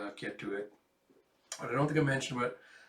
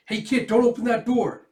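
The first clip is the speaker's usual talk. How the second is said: shouting; 10 semitones higher, 11 dB louder than usual. Acted. Adult stops child leaving.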